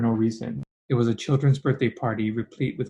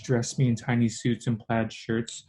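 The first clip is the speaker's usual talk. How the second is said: usual speaking voice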